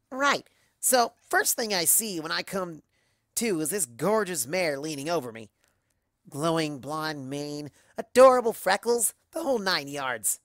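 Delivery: lively, lightly voiced boastful pony; a hint of showing off; Rainbow Dash cutting in. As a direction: jump into the tale with practised ease. Rainbow Dash jumps into story.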